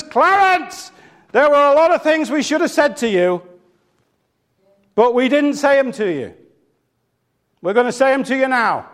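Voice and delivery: imitated preacher's high call, easing down line by line; enthusiastic, convinced yell. Minister tells the corpse what should have been said, impassioned. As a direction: address the dead man with full conviction, expecting a response. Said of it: "said it with such enthusiasm and such conviction".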